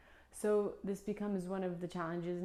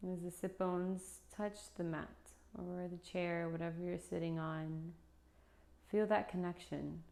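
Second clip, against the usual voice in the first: guided meditation. Acted. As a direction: lead them gently into stillness, unhurried.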